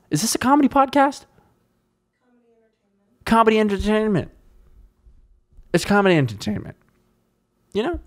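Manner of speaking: high put-on voice; silly mock voice. Host debating what his podcast is, high silly voice. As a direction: pitch it up into a silly character.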